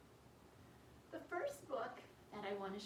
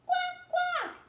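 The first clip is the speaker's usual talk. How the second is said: very high, loud quacking; duck quack; 13 dB louder than usual. Acted. Puppy George quacking, very high and loud. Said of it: "George went".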